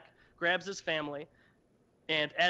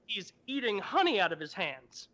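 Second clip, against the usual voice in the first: ordinary talk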